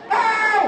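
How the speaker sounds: loud high "ow"; hammed-up yelp of pain; pained howl. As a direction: howl in comic agony. Whacked, cries out.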